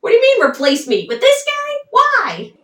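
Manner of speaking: high, loud mock indignation; upset. Upset lover learning he may be replaced, high indignant outburst. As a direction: act out a rattled lover in rapid, high protest. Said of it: "he is obviously upset at this news".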